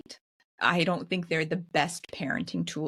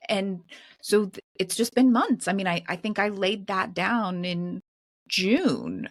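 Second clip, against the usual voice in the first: normal talking voice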